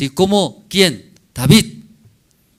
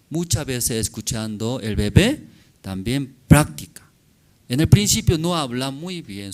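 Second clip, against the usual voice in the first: usual speaking voice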